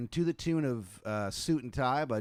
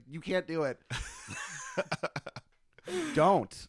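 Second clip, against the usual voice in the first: laughing mock anger; 5 semitones higher than usual. Real, not acted. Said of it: "I'm getting mad now thinking about it".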